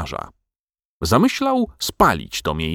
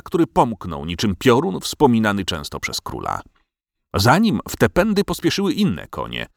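plain reading voice

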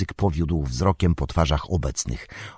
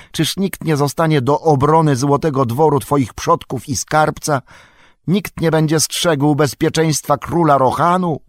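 higher, agitated protest; alarmed counsellor Wormtongue; 8 semitones higher, 4 dB louder than usual. A narrator's reading. Wormtongue protesting to the king, higher and agitated. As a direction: plead urgently against the order.

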